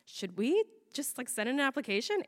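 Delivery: soft, hushed proposal; tentative suggestion. Retold or imitated. Woman to partner late at night, tentative suggestion. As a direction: float the idea very quietly.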